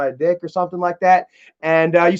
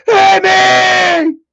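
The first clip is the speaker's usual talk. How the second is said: high, loud mock yell; imitating a tough guy yelling, much higher and louder; hammed-up macho shout; 10 semitones higher, 9 dB louder than usual. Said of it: "Talking about".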